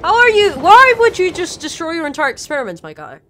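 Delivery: loud, exasperated outburst; loud exasperated outburst at a game character; exasperated yell. Real, not acted. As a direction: shout at him for ruining it.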